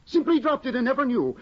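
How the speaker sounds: working himself up over the lost treasure; rising agitation. Acted. Tesman tells Hedda about the lost manuscript, building to high agitation.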